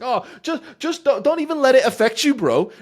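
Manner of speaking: shrill high sneering mimicry; mock the dumb advice loudly; mocking bad advice. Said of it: "the advice that people give".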